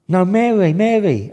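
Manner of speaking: high and pleading, correcting the name; Billy McGee's voice. Retold or imitated. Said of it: "this is how it talks".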